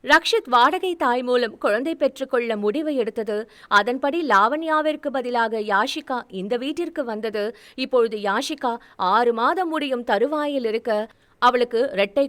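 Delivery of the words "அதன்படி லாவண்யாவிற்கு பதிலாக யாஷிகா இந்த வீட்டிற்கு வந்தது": ordinary delivery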